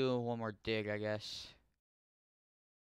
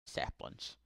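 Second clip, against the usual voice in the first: whispered; 5 dB quieter than usual. Pretend. Repeats saplings after laughing.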